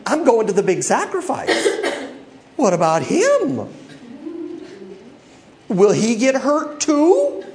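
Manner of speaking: mock self-pity; jealous complaint. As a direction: sulk like a jealous child. Voicing Peter's jealous thought.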